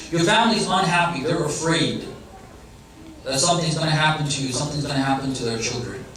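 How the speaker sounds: ordinary delivery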